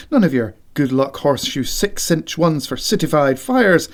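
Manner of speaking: usual speaking voice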